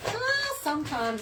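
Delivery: high, drawn-out hedging reply; imitated hedging. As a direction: stretch it high and slow, like reluctant agreement. Voicing other people hedging an answer.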